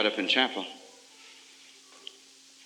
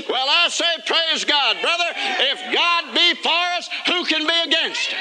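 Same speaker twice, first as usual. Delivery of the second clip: excited preaching cry; 12 semitones higher than usual. Acted. Preacher rousing the congregation, a high ecstatic cry. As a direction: lift it up like a rallying shout of faith.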